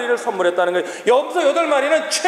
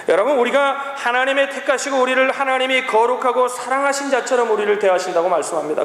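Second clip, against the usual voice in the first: normal talking voice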